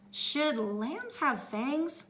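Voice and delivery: slow, worried musing; wondering. Acted. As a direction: wonder it slowly. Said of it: "she wondered".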